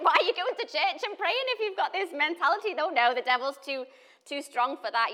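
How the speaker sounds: high, loud disbelieving retort; loud, high mock outrage; incredulous retort. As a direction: throw her words back at her in disbelief. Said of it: "I was like".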